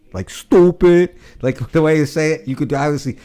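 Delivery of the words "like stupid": "stupid" drawn out slow, high and loud as "stooopid"; mocking long "stupid". Shown as a demonstration. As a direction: stretch the oo in "stupid". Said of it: "spell it like S T O O O P I D".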